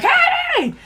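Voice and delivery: a high squeaky put-on "Hey!"; mock excited shout. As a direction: imitate her own giddy greeting. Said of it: "I'm gonna stop being like".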